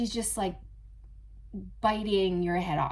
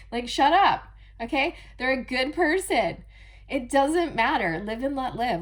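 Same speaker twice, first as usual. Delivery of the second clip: louder, higher snap back; mock retort; 4 semitones higher, 6 dB louder than usual. Mocking the friend who judges nobody, loud and raised. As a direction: brush them off loudly.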